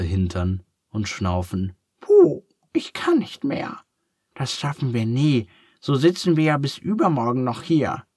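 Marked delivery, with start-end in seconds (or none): 2.0-3.8 breathless, whispered, high duck voice; out of breath. A narrator's reading. Exhausted after drinking. 4.3-8.0 mild grumbling. A narrator's reading. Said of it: "schimpft Ferdinand".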